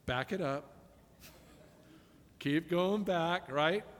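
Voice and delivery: usual speaking voice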